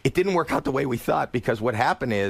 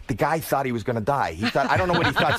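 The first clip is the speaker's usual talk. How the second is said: ordinary talk, nothing marked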